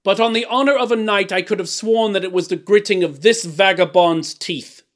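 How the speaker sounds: loud, high, emphatic bluster; blustering king. A narrator's reading. King accusing the vagabond dwarf, blustering. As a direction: protest it with lordly indignation.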